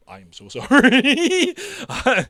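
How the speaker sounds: high, loud apology through real laughter; laughing apology. Real, not acted. Game master apologizing to players mid-narration, laughing hard. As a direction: squeal it out, helpless with laughter.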